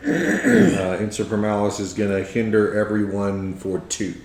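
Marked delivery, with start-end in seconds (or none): none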